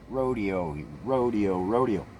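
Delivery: ordinary talk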